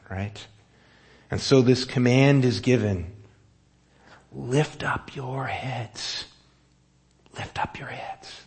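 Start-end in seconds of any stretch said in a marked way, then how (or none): 4.3-6.2 very slow, drawn-out solemn call; slow solemn command. Shown as a demonstration. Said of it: "And notice it's given twice". 7.4-8.4 soft whispered repeat of the command; whispered echo. Shown as a demonstration. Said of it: "And notice it's given twice".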